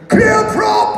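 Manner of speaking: high, loud, held shout; formal call pitched right up; warning call. Acted. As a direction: bellow the pilot's warning, high. Pilot calling warning before engine start.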